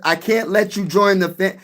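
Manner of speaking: normal talking voice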